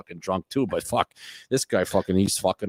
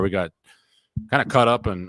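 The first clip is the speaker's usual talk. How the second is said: usual speaking voice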